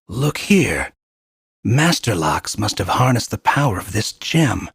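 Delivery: usual speaking voice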